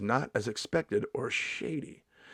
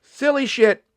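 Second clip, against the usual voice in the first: high, loud outburst; sudden loud high exclamation; 12 semitones higher, 9 dB louder, slower than usual. Real, not acted. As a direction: blurt it out, tickled. Laughing outburst.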